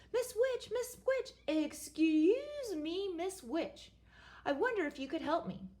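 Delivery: nervous prince. Acted. Prince addresses witch nervously.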